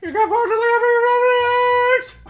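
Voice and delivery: very high, loud screech; over-the-top shouted protest; outraged courtroom yell. Acted. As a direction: scream the objection. Outburst in acted courtroom quarrel.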